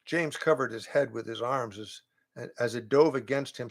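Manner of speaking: ordinary delivery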